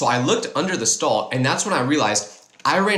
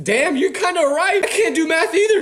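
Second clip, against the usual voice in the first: laughing realization; 3 dB louder than usual. Retold or imitated. Realizing he agrees.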